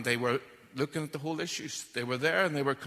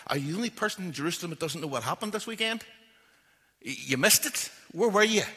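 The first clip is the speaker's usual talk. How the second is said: rising, loud amazed questions; can't believe he hasn't heard; the two travellers astonished at the stranger; incredulous disbelief; 5 semitones higher, 6 dB louder than usual. Retold or imitated.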